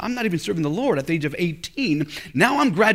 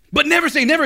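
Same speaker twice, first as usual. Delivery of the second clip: preaching punch; 9 semitones higher, 4 dB louder, faster than usual. Acted. Preacher's climactic line.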